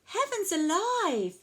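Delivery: high-pitched storybook exclamation; crying out. A narrator's reading. Old lady reacts to the dancing cow, crying out. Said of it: "The little old lady cried".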